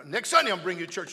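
a sudden loud fatherly warning; mock stern father shouting; scolding dad. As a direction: raise your voice like a dad laying down the law. Dad scolding son in story.

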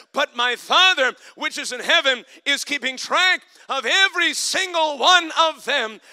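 loud, high, slowing to a shouted question; preaching at full force. Acted. Preacher voicing Jesus to the disciples, full-force preaching. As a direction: proclaim it like a pulpit climax.

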